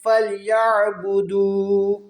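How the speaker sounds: slow, drawn-out tajweed chant; تلاوت کا انداز; Quran recitation. Shown as a demonstration. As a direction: stretch the long vowels like a reciter. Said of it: "کھینچ کر پڑھیں".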